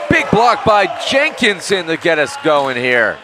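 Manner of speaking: high, loud, rising play-by-play call; excited block call. Real, not acted. Commentator calling a big block to viewers, loud and excited. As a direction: shout the big block to the crowd.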